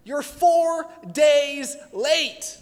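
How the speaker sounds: high, slow, drawn-out complaint; exasperated Martha. Retold or imitated. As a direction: plead it like a grieving sister who was let down. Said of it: "like Martha of saying".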